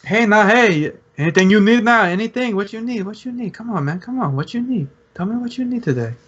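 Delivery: high, pushy street hustler pitch; street dealer hustle. Acted. Dealer hustling the players at the fountain, high and pushy. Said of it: "and he goes".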